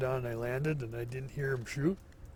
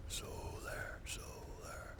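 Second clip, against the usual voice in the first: high, breathy whisper calling it out; solar, solar, whispered playfully; coaxing whisper; 11 dB quieter, much slower than usual. Pretend. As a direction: whisper to lure it out of hiding. Coaxing whisper to hidden item.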